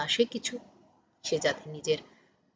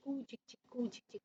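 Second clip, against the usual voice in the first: train noise imitation; 19 dB quieter, much faster than usual.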